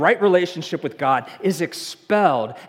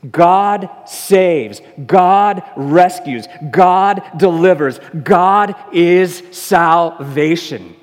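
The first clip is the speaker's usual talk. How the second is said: loud, slow, proclaiming each line; a booming sermon declaration; full-force preaching; 8 dB louder, much slower than usual. Acted. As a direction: hammer each truth home to the congregation. Preaching the name's meaning.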